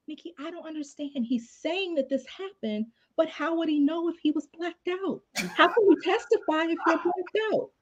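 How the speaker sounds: high, soft, earnest confusion; puzzled coworker. Retold or imitated. Young prosecutor coming to a cubicle for help, high and puzzled. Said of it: "she comes to my cubicle and she's like".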